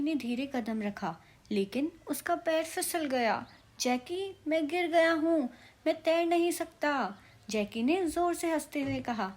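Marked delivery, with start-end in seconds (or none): 3.8-7.2 flail and call out in fear; panicked cry. A narrator's reading. Packie panics after slipping. (डर से ऊँची घबराई पुकार)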